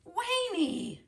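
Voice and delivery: shouting high for a brother; calling out. Acted. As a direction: call him across the farm.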